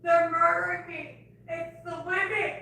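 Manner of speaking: real panicked outcry; distressed cry. Real, not acted. Distressed outburst.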